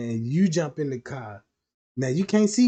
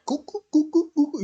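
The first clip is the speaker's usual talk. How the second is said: high clopping hoof sounds; horse hoof clops; 12 semitones higher, 5 dB louder, faster than usual. Retold or imitated. Mimicking heels stomping like a horse down the aisle. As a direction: clop like heels hitting like a stallion. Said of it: "it sound like a horse".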